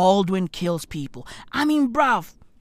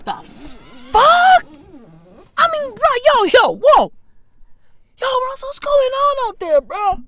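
mock panic; 14 semitones higher than usual. Panic at a noise outside.

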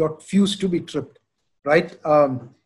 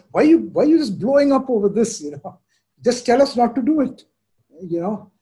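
indignant son; 6 semitones higher than usual. Retold or imitated. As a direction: push back at a yelling dad. Son protesting his father's yelling.

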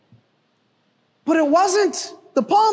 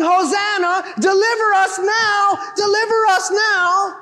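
raised pleading "deliver us now"; voicing the crowd's cry; 6 semitones higher than usual. Preacher voicing the crowd's plea to Jesus, raised. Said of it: "These people shouting".

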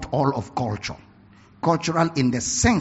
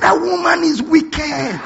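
mock shout; 12 semitones higher, 5 dB louder, slower than usual. Friend's punchline shouted.

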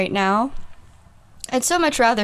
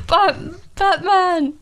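raised British actor impersonation; Michael Caine; 5 semitones higher, slower than usual. Acted. Guest attempting the actor's voice, raised and hammed-up. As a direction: call out in a put-on English voice. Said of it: "I think it's Michael Caine".

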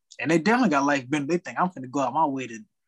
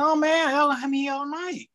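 annoyed customer; 11 semitones higher than usual. Retold or imitated. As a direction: complain up high like an entitled customer. Customer complaining, quoted.

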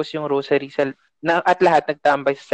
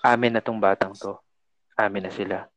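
low, quiet menacing claim, sinking softer at the end; possessing voice; 6 semitones lower, 5 dB quieter than usual. Retold or imitated. Possessed girl speaking to the priest in a low menacing voice. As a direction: voice the thing inside the girl, lightly menacing. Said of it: "nagsalita in another one's voice".

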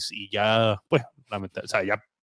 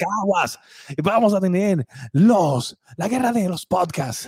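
wrestling promo hype; 7 semitones higher, 5 dB louder, slower than usual. Acted. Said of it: "Parece promo de lucha libre".

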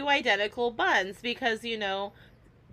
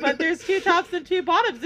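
excited insistence; 7 semitones higher, 5 dB louder than usual. Retold or imitated. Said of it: "But I went".

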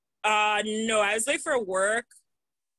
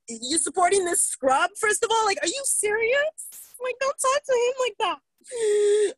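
high, whiny imitation of the roommate; mock her in a much higher voice; imitating the roommate defending the squatter, high and whiny; mock whiny girl; 9 semitones higher than usual. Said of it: "say some shit about".

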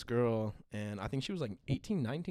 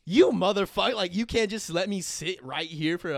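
high, loud exasperated protest; outraged teenage pleading, louder and higher; indignant; 6 semitones higher, 8 dB louder than usual. Retold or imitated. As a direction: retell a heated argument with raised voice. Arguing with the staff, retold.

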